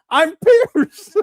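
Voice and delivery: high, loud mock woman's voice cracking with laughter; laughing mock voice. Mocking her words to viewers, high, loud and laughing. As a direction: squeal her line while laughing.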